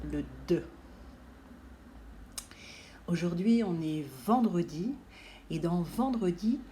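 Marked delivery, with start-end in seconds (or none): 0.5-0.6 "D" said as the short sound "de", not the letter name; "D" as one brief voiced consonant sound; isolated letter sound. Shown as a demonstration. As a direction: give just the first sound of "D". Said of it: "tout premier bruit que je fais".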